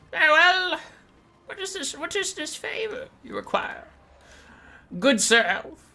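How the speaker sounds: very high-pitched bird-creature voice; Malagor. Acted. Bird demon asks the elf his favor, high creature voice. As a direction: squawk it high, with mock formality.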